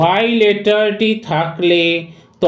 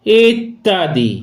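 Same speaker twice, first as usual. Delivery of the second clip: normal talking voice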